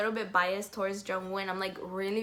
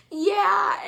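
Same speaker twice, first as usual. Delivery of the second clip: much higher, louder, tearful yes; eager yeah; 10 semitones higher, 9 dB louder than usual. Retold or imitated. Fan answers idol about the show, high eager yeah. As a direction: blurt it out overjoyed. Answering idol's question excitedly.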